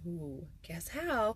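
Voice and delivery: drawn-out slow tease; string them along playfully; teasing. Real, not acted. Host teasing viewers before the reveal, slow and coy.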